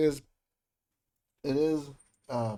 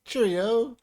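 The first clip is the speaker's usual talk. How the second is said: mock British cheerio; 9 semitones higher, 7 dB louder than usual. Greeting UK players in accents.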